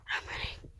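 unvoiced whisper while fleeing; scared whisper. Real, not acted.

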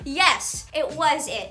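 ordinary talk, nothing marked

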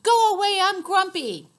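loud, high grumpy shout; grumpy yell. Acted. Elephant yelling at the door, loud grumpy shout. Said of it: "he yelled".

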